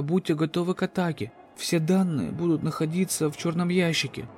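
urgent radio call. Acted. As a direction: call for help over the radio. Captain's distress radio message.

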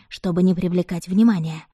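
ordinary delivery

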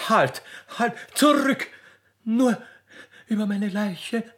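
high, alarmed cries of halt, shouted zurück; frantic plea. Acted. Notzel shielding her master, high and frantic. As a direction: fling yourself between them crying stop. Notzel throws herself between them.

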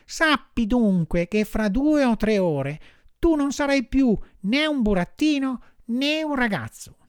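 high-pitched marmot pronouncement; high animal doctor voice; solemn little marmot. A narrator's reading. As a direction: announce the fate in a high, sustained voice.

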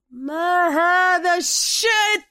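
drawn-out, high, furious shout; angry scream. A narrator's reading. Bruce clutching his head at the costume, screaming angrily. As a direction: stretch it into an exasperated yell. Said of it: "صرخ بروس ممسكا رأسه بغضب".

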